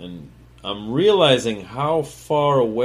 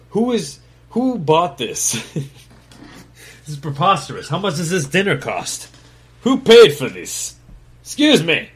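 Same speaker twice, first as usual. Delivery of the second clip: incredulous outburst; 7 semitones higher, 6 dB louder than usual. Real, not acted. Laughing disbelief at truffle pile.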